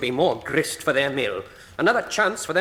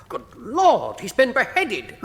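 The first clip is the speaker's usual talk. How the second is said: shocked exclamation; 7 semitones higher, slower than usual. Acted. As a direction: recoil at the sight of the body. Discovers the beheaded body.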